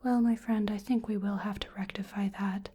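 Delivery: ordinary delivery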